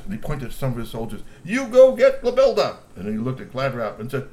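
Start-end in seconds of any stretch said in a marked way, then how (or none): 1.4-2.8 high, loud command; barked order. Acted. Mister Glove commands soldiers, high and loud. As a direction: order them out.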